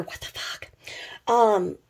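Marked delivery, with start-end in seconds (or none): 0.0-0.7 breathy whisper of disbelief; whispered quote. Retold or imitated. Friend confronting Irma, in a whispered what the fuck. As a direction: whisper it, stunned. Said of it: "really asked her".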